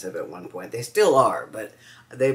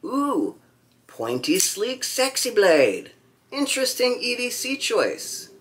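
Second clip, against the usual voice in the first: acted admiration; 11 semitones higher than usual. Voicing own thought on seeing a sexy blade, admiringly. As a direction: coo it with pitch up high. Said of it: "I think".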